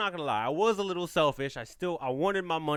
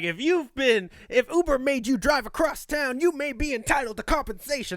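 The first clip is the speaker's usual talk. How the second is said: high, announcer-style pitch of a TV injury-lawyer ad; mock announcer pitching a class-action payout; 7 semitones higher, 4 dB louder than usual. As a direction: sell it like a late-night legal commercial. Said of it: "you know those, like, commercials is like".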